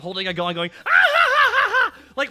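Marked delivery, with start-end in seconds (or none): 0.8-1.9 high, loud maniacal cackle; big hammed-up villain laugh; mock clown laugh. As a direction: imitate a cackling killer clown. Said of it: "the clown laughing at him".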